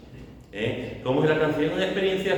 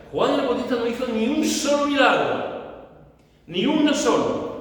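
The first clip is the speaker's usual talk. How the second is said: raised, high-pitched pulpit delivery; emphatic preaching; 6 semitones higher than usual. Real, not acted. Preacher telling the church John did no miracle, emphatic preaching. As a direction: drive each example home, pitch leaping up on key phrases.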